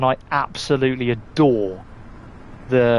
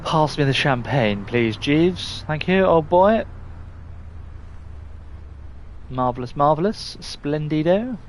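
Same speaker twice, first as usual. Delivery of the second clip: mock posh toff addressing a butler; posh accent. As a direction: put on a hammed-up posh English accent. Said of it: "I'm a posh boy".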